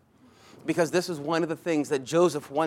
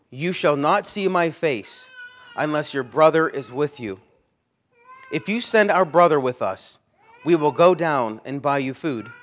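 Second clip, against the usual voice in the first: louder, forceful preaching read; scripture reading; 6 dB louder than usual. Acted. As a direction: proclaim the verse to the hall.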